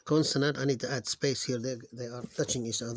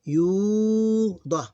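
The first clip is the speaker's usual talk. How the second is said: slow syllable demo; 8 semitones higher, 7 dB louder, slower than usual. Shown as a demonstration. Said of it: "three syllables".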